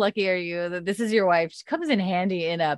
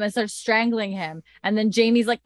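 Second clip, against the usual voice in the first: usual speaking voice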